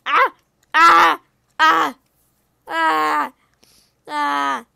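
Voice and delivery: string of short loud screams; panicked yelps. Pretend. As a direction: yelp each time you take a hit. Attacked by mobs in game.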